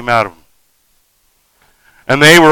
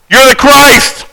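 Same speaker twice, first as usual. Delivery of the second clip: yelling demon; 8 semitones higher, slower than usual. Acted. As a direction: shriek it like the possessed boy. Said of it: "The demon in that boy is yelling out".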